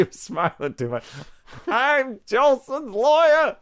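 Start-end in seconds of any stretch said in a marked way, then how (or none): 1.7-3.6 mock lawyer voice. High put-on lawyer voice.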